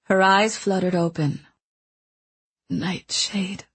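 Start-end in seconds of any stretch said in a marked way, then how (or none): 2.7-3.6 slow, breath-short whispered confession; weak dying whisper. Acted. Dying mentor confesses her past to the boy, weak whisper. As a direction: let each phrase cost her breath.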